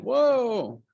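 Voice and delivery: high, startled mock whoa; imitating a startled colleague; surprised whoa. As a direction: yelp it like a startled man. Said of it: "Gordon's waving his arms up here going".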